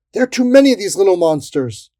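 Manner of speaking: high, loud complaint; alarmed cry. A narrator's reading. Soldier outnumbered by fairies, alarmed cry. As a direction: cry out that you are outnumbered.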